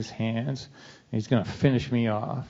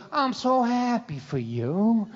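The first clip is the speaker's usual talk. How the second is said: high, slow mock-happy sarcasm; sweetly poisonous congratulations; sarcastic; 9 semitones higher, slower than usual. Dad sarcastically congratulating his son, high and slow.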